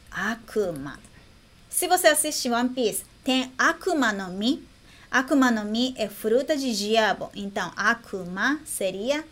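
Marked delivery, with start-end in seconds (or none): none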